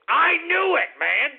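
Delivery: high and loud I knew it; excited outburst. Real, not acted. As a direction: crow it out, vindicated. Vindicated host bursts out.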